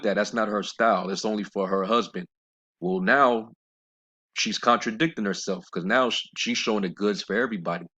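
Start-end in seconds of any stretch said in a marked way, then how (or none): none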